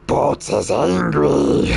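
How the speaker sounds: slow, menacing goblin voice; angry goblin. Acted. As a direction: let the rage seep in, slow and odd. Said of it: "anger starts to fill in".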